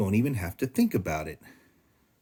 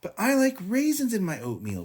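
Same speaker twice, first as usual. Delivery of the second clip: protesting; 12 semitones higher than usual. A narrator's reading. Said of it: "protested Sister".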